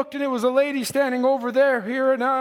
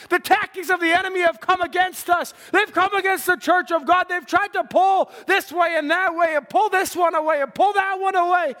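raised, insistent pulpit exhortation; fervent preaching; 5 semitones higher than usual. Real, not acted. Preacher urging the church to believe tonight, fervent preaching. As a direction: drive each phrase at the congregation, building in force.

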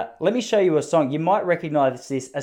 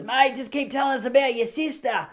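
higher mock heckler voice; heckling bloke; 9 semitones higher than usual. Heckler at the back of the room, mock voice. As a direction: lob it like a drunk heckler. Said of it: "five blokes at the back of the room goes".

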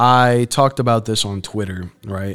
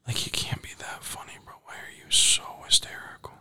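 hushed, breathy whisper so the neighbours won't hear; irritated under-the-breath whisper; whispered complaint; 3 semitones higher, 9 dB quieter than usual. Real, not acted. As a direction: whisper it in exasperation at the noise. Whispering about loud neighbours mid-recording.

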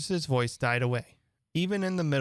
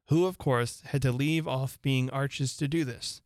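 ordinary delivery, nothing marked

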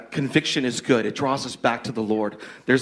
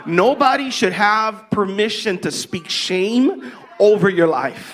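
preaching; 8 semitones higher, 4 dB louder, slower than usual. Real, not acted. Preaching with passion to the host.

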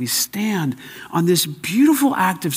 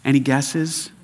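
ordinary delivery

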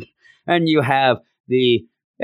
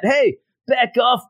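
acted brush-off; 9 semitones higher than usual. Acted brush-off in a retold argument.

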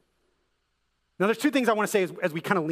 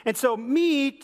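usual speaking voice

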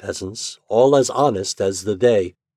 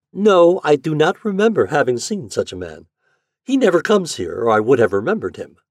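put-on a girl's higher voice, feigning calm; Yuli, much higher, hiding her fear; young Yuli's voice; 7 semitones higher, 7 dB louder than usual. Yuli feigns ignorance to stranger.

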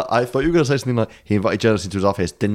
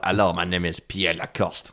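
lower, mock-French introduction; French accent; 4 semitones lower, 4 dB quieter than usual. Podcaster playing the French admiral for fun, French accent. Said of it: "fun excuse to do accents more".

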